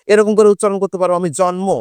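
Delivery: ordinary talk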